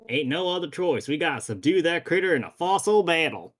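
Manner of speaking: bad Western accent. Cowboy rallying a kid to fight a dinosaur, bad Western accent. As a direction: lay on a corny Western accent. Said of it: "my incredibly bad Western accent".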